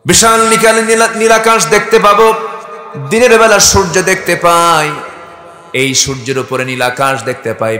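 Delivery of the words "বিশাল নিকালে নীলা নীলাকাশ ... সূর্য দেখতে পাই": high, loud sustained proclamation; full-force preaching. Acted. Preacher proclaiming God's creation to the crowd, high and loud. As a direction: raise the voice and proclaim it.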